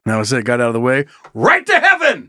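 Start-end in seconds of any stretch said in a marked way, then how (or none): 1.3-2.3 high hollered proclamation; mock exclamation. Host hollers the punchline of his confession story, mock exclamation. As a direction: holler it upward in a burst.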